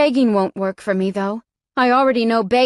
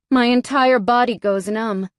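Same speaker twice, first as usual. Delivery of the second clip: usual speaking voice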